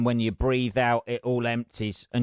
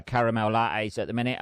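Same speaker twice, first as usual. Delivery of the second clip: usual speaking voice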